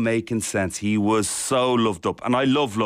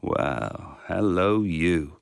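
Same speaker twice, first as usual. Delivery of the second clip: deepest voice; 3 semitones lower, much slower than usual. Retold or imitated. Husband greeting his partner at the front door in his deepest voice. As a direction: drop right down into your deepest voice. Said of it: "said in my deepest voice".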